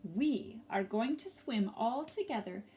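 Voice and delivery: gentle storybook narration with lively swells; reading to children. A narrator's reading. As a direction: tell it like a picture book read to a class.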